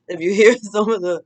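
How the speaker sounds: words broken by real laughter; laughing through words. Real, not acted. Telling friends' stories, laughing through the words. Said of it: "I'm sorry I'm laughing".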